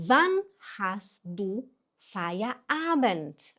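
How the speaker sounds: very slow model German, word by word; pronunciation demo. Shown as a demonstration. Teacher models the third phrase for learners, slow pronunciation demo. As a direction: say it slowly, with gaps for learners. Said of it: "Atenção na pronúncia".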